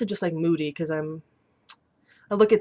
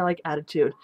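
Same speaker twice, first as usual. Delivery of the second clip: ordinary delivery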